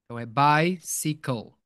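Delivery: bicycle, bai-si-col, ending like call; careful model. Shown as a demonstration. Teacher correcting the ending for students, carefully. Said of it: "É call".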